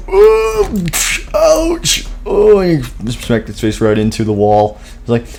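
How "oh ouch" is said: ouch. Acted. Smacks face into wall.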